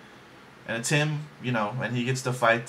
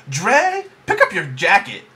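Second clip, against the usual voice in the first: sassy mom attitude; 6 semitones higher, 7 dB louder than usual. Retold or imitated. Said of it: "she's always like".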